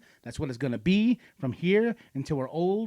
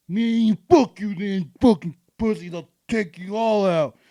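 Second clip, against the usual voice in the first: put-on slow, drawn-out drunken cursing; imitated drunk, loud and dragging; belligerent drunk; 4 semitones higher, 5 dB louder, slower than usual. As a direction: slur a threat like a staggering drunk. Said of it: "this drunk native dude comes up".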